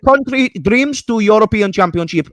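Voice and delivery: high, loud mock Italian accent. Player gloating over a fan's country, mock Italian accent. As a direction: boast in a hammed-up Italian accent. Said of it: "that doesn't sound Italian".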